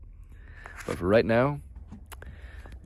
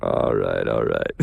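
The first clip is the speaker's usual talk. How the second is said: deep mock voice; 13 semitones lower than usual. Low mock voice after laughing.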